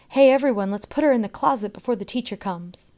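loud, high, fast call to the gang; rally the others loudly and quickly. A narrator's reading. Boy rallies the class.

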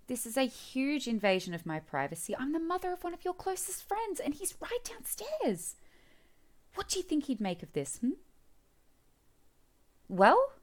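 put-on mother scolding the boy for invading her privacy, loud. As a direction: tell him off at full volume. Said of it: "I apologize for raising my voice".

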